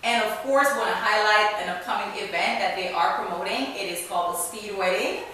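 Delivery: loud, upbeat show-opening announcer read; podcast host intro. Acted. Host welcoming listeners and plugging the sponsor, announcer style.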